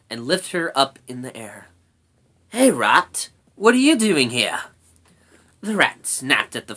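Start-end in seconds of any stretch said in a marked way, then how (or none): 2.5-4.6 loud, higher challenge; feisty brownie calling out a rat. A narrator's reading. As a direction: pipe up loudly, demanding an answer. Story figure Sorrel challenges the rat.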